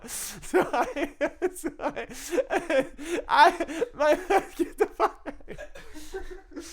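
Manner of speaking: helpless high-pitched laughter through the words; laughing hard. Real, not acted. Cracking up at the bogus excuse, real helpless laughter. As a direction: barely get the words out for laughing. Cracking up at his own story.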